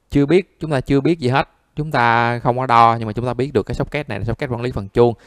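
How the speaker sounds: usual speaking voice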